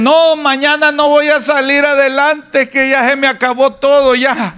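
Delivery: high, defeated moaning; mock despair of a fearful person; hopeless whining complainer. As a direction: act out someone giving up on tomorrow. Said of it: "y que dicen".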